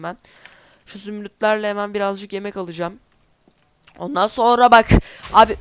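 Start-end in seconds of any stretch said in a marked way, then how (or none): 4.0-5.6 much louder, shocked exclamation; sudden loud cry on seeing the wrecked house; loud outburst. Real, not acted. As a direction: burst out at the sight of the damage. Discovers creeper damage.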